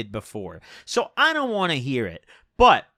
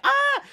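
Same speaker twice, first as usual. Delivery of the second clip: short, high-pitched imitated yell; mock scream. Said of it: "like, a yell".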